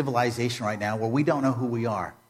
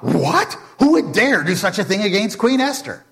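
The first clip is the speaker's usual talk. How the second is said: Haman's startled echo, higher and louder; shocked Haman; 8 semitones higher, 5 dB louder than usual. Acted. Haman echoing the king in fake outrage, shocked. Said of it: "Haman is shocked".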